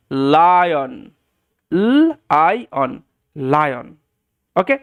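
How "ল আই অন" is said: "এল আই on" as held l, long ai, then on; sounding out. Shown as a demonstration. Teacher showing the new way of reading, sounded out slowly. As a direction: break lion slowly into three separate sounds. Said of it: "sound করে পড়াটা".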